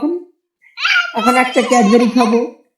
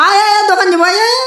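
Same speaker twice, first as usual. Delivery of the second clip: high coaxing call; 8 semitones higher, faster than usual. Real, not acted. Calling the child to come.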